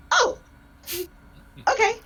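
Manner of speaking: high, loud startled exclamations; startled yelp. Acted. Player voices own character startled by a trident, startled yelps. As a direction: jolt with shock, pitched high. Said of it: "I let out a scream".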